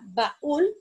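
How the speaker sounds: "baúl" slowly broken into ba... ÚL; syllables, stress on ÚL. Shown as a demonstration. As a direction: separate the vowels of "baúl", holding ÚL. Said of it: "el mismo caso de Raúl".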